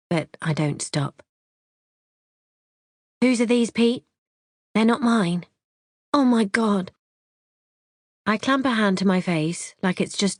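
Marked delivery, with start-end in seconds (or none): 3.2-6.9 higher, accusing put-on shock; mock outrage. A narrator's reading. Wife confronting husband Pete over the knickers, higher and accusing. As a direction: play the wounded wife discovering the truth.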